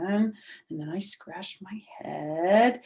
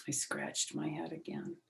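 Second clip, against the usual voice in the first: ordinary delivery, nothing marked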